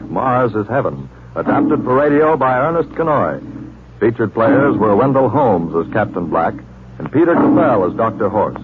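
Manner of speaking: ordinary talk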